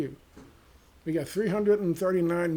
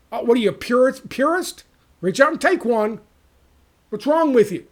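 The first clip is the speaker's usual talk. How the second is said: mocking scold; 8 semitones higher, 10 dB louder than usual. Mocking a voter as purist.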